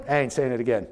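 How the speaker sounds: husband on his phone refusing his wife, low and grudging; sulky low mutter. Retold or imitated. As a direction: mumble it, eyes still on the game. Husband refusing while gaming.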